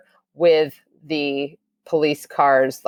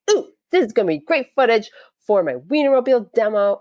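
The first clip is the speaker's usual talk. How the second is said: 1 giddy excitement; 7 semitones higher than usual. Retold or imitated. Excited about filming the stop.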